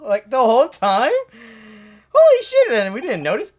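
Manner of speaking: loud, high, incredulous yelling; outraged shouting. Acted. Players shout at the judge over the wait, outraged. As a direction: blow up at the wasted hour.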